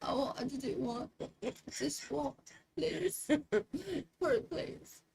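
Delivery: soft, high mock British pleading; imitating a British-accented plea; imitated British accent. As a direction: beg quietly in a put-on British accent. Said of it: "can do a great British accent".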